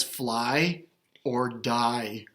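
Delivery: ordinary delivery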